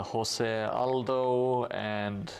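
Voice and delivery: ordinary talk, nothing marked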